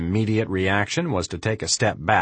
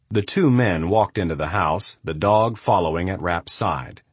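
ordinary delivery, nothing marked

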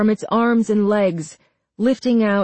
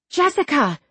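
alarmed cry; 7 semitones higher than usual. A narrator's reading. Marla calling to Jessica at the door, alarmed cry. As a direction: call out to her in alarm, pitch raised. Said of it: "Marla cried".

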